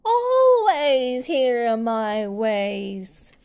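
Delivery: slow, loud, strange-voiced decree; domineering queen. A narrator's reading. Queen of Hearts lays down the law, slow and loud. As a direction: hammer each word down. Queen angrily asserts her rule.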